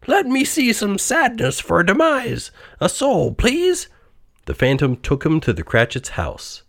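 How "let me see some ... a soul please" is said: high-pitched pleading character voice; Scrooge. A narrator's reading. Old miser asks phantom for some mourning, high pleading voice. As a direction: beg the phantom, pitched well up. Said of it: "said Scrooge".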